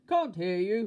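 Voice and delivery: very loud, high shopkeeper shout; booming holler; thundering shout. A narrator's reading. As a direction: thunder it at the customer. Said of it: "roared the milkman".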